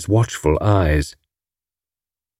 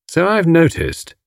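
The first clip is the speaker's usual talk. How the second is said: slow, pointed, raised-pitch coolness; cold; 7 semitones higher, slower than usual. A narrator's reading. Tommy on his wife's new friendship, coldly. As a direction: draw it out with cool disapproval. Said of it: "said Tommy coldly".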